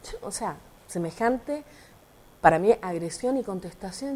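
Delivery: ordinary talk, nothing marked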